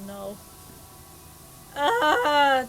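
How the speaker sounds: usual speaking voice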